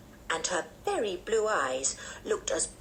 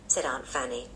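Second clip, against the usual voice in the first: ordinary delivery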